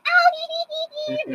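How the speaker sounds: very high coy tittering; mock giggle. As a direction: imitate mom's embarrassed little giggle. Mom giggling at dad's joke.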